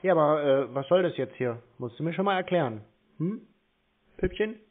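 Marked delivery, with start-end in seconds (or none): none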